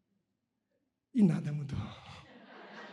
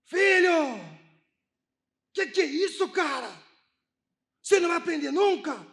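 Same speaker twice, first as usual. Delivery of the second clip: mock furious yell; 6 semitones higher than usual. Father losing it at his son over dinner, reenacted yell. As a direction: bark it at the kid like an old brute. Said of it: "eu gritei como se eu fosse ele".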